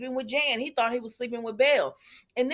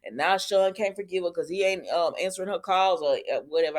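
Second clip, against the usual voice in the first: usual speaking voice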